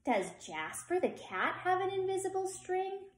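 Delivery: put-on high child's voice; little girl asking. A narrator's reading. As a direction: ask like a curious small girl. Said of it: "Liza asked".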